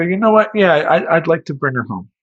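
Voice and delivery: impulsive. Retold or imitated. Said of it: "I just go".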